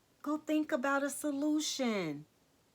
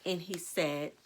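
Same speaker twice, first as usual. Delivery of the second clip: usual speaking voice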